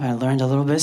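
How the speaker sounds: normal talking voice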